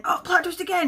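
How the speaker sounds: high-pitched mock announcement; mock surprise. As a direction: exclaim it as yet another sudden surprise. Mimics twists piling up in book.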